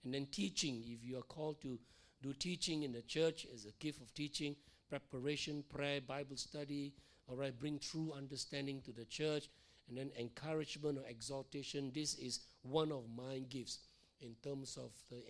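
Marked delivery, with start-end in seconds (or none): none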